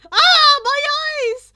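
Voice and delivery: very loud, high shriek of a dazzled villain; pained cry. Acted. Villain blinded by Izuku's dancing, shrieking loudly.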